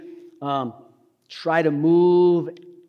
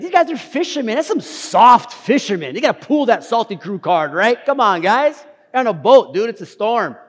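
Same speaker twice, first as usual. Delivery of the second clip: higher, louder scoffing; mocking; 6 semitones higher, 5 dB louder than usual. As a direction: razz them like a buddy, incredulous. Mocking the disciples as soft fishermen.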